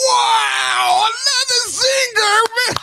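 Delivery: high-pitched delighted cry at the zinger; excited squeal. Real, not acted. Streamer shrieks at Trump's post, high and delighted. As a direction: squeal it out, cracking up.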